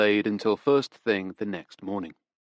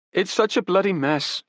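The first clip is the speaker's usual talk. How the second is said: put-on higher, urgent young woman pleading; desperate young woman; 10 semitones higher than usual. Daughter defends her father to detectives, desperate. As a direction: grasp for excuses, pitched up.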